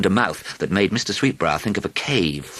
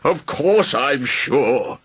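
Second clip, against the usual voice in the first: higher, slow moaning dragon; 8 semitones higher, 3 dB louder, much slower than usual. A narrator's reading. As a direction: moan self-pityingly. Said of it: "the dragon moaned".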